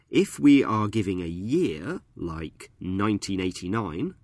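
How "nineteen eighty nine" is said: "nineteen eighty-nine" with "nine" of nineteen stressed; "nineteen eighty-nine", nineteen stressed on its first syllable. Shown as a demonstration. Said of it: "we stress the first syllable of nineteen".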